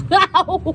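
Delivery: sudden yelp of pain; sharp ouch. Real, not acted. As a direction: cry out from a knocked knee. Knee hit, cries out.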